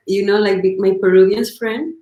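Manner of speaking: normal talking voice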